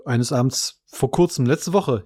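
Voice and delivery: ordinary talk, nothing marked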